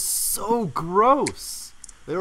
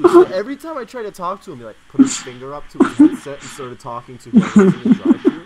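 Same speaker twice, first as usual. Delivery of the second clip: laughing while telling; 3 semitones higher, 8 dB louder, faster than usual. Real, not acted.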